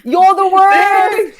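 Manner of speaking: high, loud, slow jeer; heckling shout. Acted. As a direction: yell it at the stage. Heckling the contestants.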